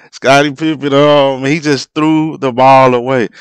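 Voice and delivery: exasperated. Real, not acted. Repeats turnover call.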